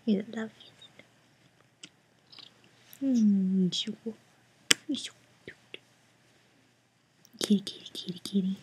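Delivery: whisper. Real, not acted. Whispering sweetly to the cat.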